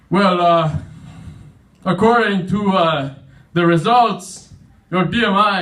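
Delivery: loud, halting doctor delivering results; hesitant doctor. Acted. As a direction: stall awkwardly between phrases. Doctor stalls over bad results.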